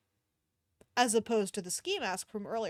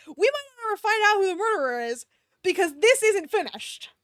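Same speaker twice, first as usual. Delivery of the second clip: dismayed wail; 8 semitones higher, 6 dB louder than usual. Real, not acted. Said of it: "Oh, no, dude".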